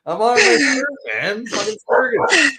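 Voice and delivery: high, crying mock complaint; mock whine. Host in a high, crying mock whine about being interrupted. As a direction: whine like a kid cut off mid-rant.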